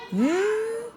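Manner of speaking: lightly hammed-up odd cartoon voice; a mock strange voice teasing the others; taunting the players in a goofy voice; silly put-on voice. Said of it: "तुम लोगों की आवाज़ में क्या हुआ".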